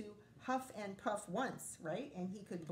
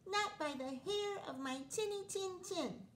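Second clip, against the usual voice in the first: high squeaky little pig; 11 semitones higher than usual. Acted. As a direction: pipe up high like a tiny pig. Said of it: "said the little pig".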